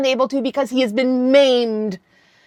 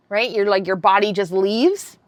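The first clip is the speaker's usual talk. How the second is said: ordinary delivery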